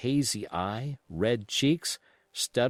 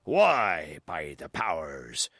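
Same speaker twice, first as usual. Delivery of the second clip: cried out; 7 semitones lower, slower than usual. Acted. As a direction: bark it like a seasoned cook. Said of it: "cried Long John".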